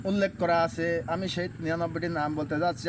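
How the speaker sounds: normal talking voice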